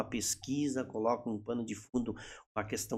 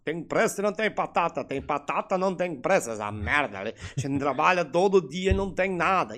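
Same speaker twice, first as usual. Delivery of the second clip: bitter complaint; 5 semitones higher, 4 dB louder than usual. Retold or imitated. Voices colonist's bitter complaint.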